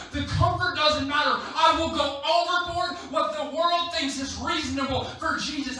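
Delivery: high, loud, impassioned declaration; rally the congregation with a bold vow; full-force preaching. Real, not acted.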